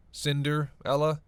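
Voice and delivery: "Cinderella" slowly broken into "Cinder" and "ella"; loud, deliberate "Cinderella", pausing after "Cinder"; pun spelled out. Acted. Spells out own pun to companion.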